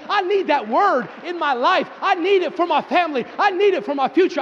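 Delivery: high, insistent, driving pulpit cadence; rousing preacher crying out to God; fervent preaching. Acted. Preaches at full force.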